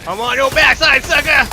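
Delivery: gloating high yell at the enemy; cocky, loud taunt; taunting shout. Real, not acted.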